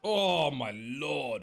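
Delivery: exasperated drawl. Real, not acted. Caster reacts to broken team.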